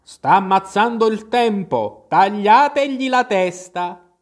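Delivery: screaming queen. Retold or imitated. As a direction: shriek the royal order. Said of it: "la regina ha cominciato ad urlare". (la regina urla, imitata)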